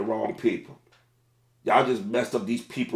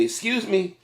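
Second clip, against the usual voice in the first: raised, polite "excuse me" to a sleeping driver; polite crew call; 9 semitones higher, 3 dB louder than usual. Retold or imitated. Crew member politely addressing sleeping drivers, raised polite voice. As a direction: politely wake the customer in a higher voice. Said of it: "and say".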